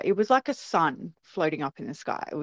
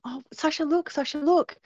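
high-pitched, excited urging; urgent calling; 10 semitones higher than usual. Retold or imitated. Sister and Mum urging the frightened girl, excited. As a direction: imitate someone calling a child to look.

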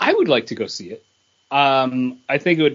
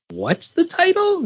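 high questioning voice; slower than usual. Retold or imitated. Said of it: "Caitlin was like".

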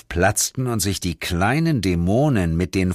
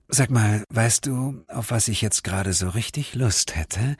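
seductive breathy purr; 3 dB quieter than usual. Acted. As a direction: whisper it as a temptation. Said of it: "hauchte sie mir verführerisch zu". (verführerisch gehaucht)

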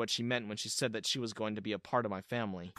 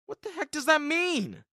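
much higher, louder shocked demand; alarmed; 14 semitones higher, 5 dB louder than usual. Acted. Terrence demanding an answer from Diana, shocked. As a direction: recoil in alarm.

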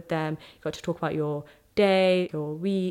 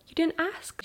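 much higher, playful mock coyness; coy teasing quote; 11 semitones higher than usual. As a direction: tease like she's been waiting to be asked. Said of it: "she'll be like".

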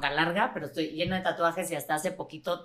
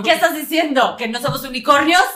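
higher and louder, hammed up; mock outraged retort; 7 semitones higher, 10 dB louder than usual. Mother mocking the school teacher, loud hammed-up retort. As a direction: fire back like a wronged unicorn. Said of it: "mock retort to teacher".

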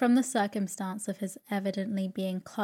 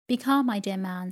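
plain reading voice

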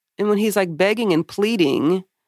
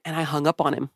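normal talking voice

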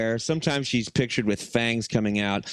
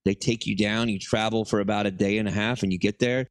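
usual speaking voice

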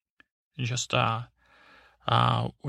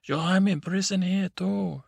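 crazy yell; 7 semitones higher than usual. Retold or imitated. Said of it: "he goes crazy".

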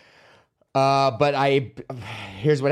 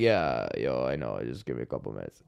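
much lower and softer, groggy from sleep; just-woken-up voice; 7 dB quieter than usual. Retold or imitated. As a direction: mumble it like you just rolled out of bed. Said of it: "Like that voice when you're, like, just waking up".

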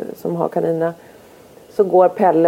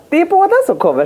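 high announcing voice; 12 semitones higher, faster than usual. Retold or imitated. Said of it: "jag bara".

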